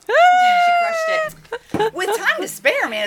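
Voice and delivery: squealed, high and loud; excited high squeal. Acted. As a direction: shriek it out in delight. Announcing the win, squealing.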